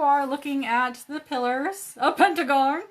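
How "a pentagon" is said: loud, dramatic exclamation; dramatic reveal. Shown as a demonstration. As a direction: announce it with drama. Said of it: "I almost didn't do it dramatically".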